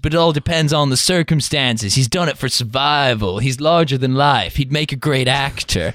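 put-on British man putting on an American accent, slow and drawn out; botched American accent from a Brit. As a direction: drawl it in a wobbly fake American accent. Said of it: "he's sort of doing an American accent".

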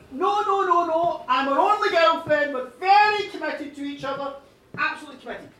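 loud, rushing-in denial; acted adamant protest; emphatic insistence. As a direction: cut in loudly and insist. Woman insists she is faithful.

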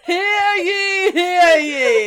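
loud, ringing proclamation; town crier. Pretend. Mock town crier announcement.

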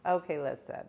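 ordinary talk

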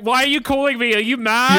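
high-pitched anxious actor on a call; mock-panicked, high and needy; insecure actor. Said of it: "what I do whenever my agent calls me".

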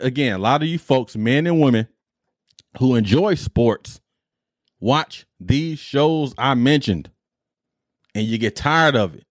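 ordinary talk, nothing marked

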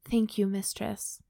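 measured, even audiobook read; composed narrator, pausing between phrases; story narration. A narrator's reading. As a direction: read the story aloud with clear, careful phrasing. Reads erotic fiction aloud.

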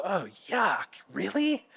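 soft, muted disgusted reaction; mock distaste. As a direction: recoil quietly, ending on a questioning rise. Reacting to unpleasant Bible passage.

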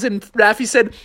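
usual speaking voice

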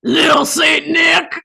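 a drawn-out dramatic yell of the title; hammed-up shout. Cohost reads the song lyrics as a shouted dramatic refrain.

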